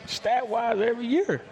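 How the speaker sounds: a reply to a silly question, caught between a laugh and outrage; incredulous disbelief. Retold or imitated. Answering fans who doubt the quarterback, high and incredulous with a laugh. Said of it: "I'm like".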